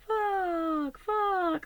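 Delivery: high, far-off echo of the swear, fading; imitating an echo. Telling viewers about the hill, she mimics the distant echo. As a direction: mimic the hillside throwing it back at her. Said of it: "like, it echoed".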